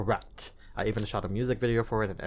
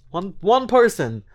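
One, one person high, loud and slow; stress on one; 10 semitones higher, 10 dB louder, slower than usual. Real, not acted. Singer insists one listener is enough, stressing One, one person. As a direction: hit One, one person hard. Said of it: "stressing one listener matters".

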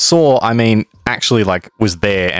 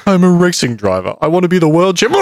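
mock boast; 7 semitones higher than usual. Mocking the nervous driver.

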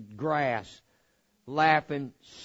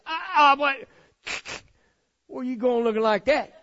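1 shocked homeowner; 10 semitones higher, 7 dB louder than usual. Retold or imitated. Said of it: "You see somebody come into your house".

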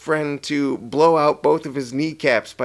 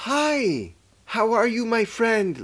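high, sing-song greeting to a pet; like talking to a dog; 9 semitones higher, slower than usual. Retold or imitated. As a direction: coo at him like a little puppy. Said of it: "Like he's a little dog".

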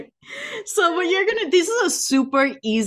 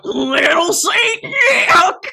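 higher, louder, very slow yell; dramatic wail; 7 semitones higher, 5 dB louder, much slower than usual. Shown as a demonstration. Joking about sending random voice memos, wailing loud. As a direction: stretch the title into a wailing cry. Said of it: "Just me going".

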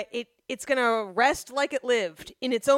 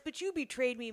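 ordinary delivery

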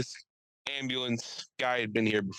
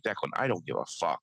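much lower, deadpan brush-off; lower dismissive voice; 7 semitones lower than usual. Retold or imitated. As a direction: drop it low and bored.

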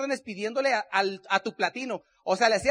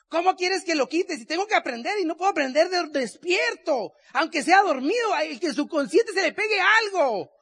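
higher, louder, frustrated pleading to the wife; exasperated plea; 6 semitones higher, 4 dB louder than usual. Retold or imitated. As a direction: plead with her, frustrated and worked up. Discusión recordada con la esposa. (ruego frustrado, más agudo y más fuerte)

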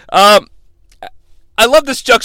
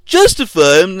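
botched impression of a growling wrestler; mock game voice; Triple H; 2 semitones lower than usual. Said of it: "that's not Hunter".

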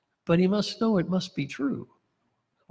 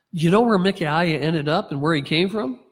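ordinary delivery

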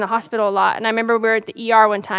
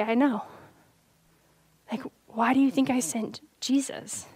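softer, hushed and gentle; subtle, gentle voice of God; 8 dB quieter than usual. Retold or imitated. Spirit answering the pastor's lament, soft and gentle. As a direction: let God's reply barely land, tender. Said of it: "so subtly just say".

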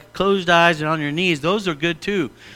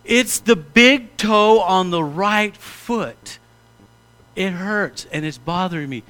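earnest, simple, unhurried; plain honest request. Shown as a demonstration. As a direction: spell it out plainly to God. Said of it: "Get real".

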